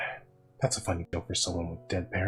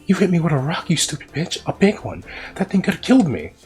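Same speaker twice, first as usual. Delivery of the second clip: angry yell; 10 semitones higher, 8 dB louder than usual. Acted. Boy yelling at old woman.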